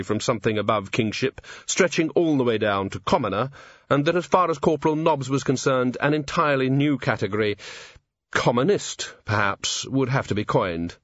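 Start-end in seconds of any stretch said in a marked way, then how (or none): none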